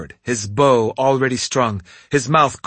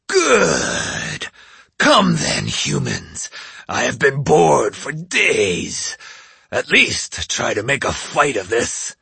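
demon voice; slower than usual. Acted. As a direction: taunt them like a bored giant. Oni taunts humans.

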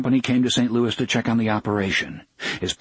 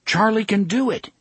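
higher, insistent plea; 9 semitones higher than usual. A narrator's reading. Engineer insists to the railway boss that Charlie can manage, insistent. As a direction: insist on it with raised pitch. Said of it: "Engineer Bob insisted".

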